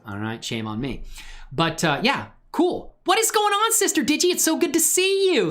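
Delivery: usual speaking voice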